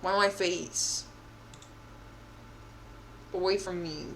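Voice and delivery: ordinary talk, nothing marked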